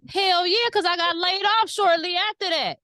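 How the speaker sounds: high, loud burst of confirmation; emphatic exclamation. Real, not acted. Telling friend the dream reading came true, emphatic.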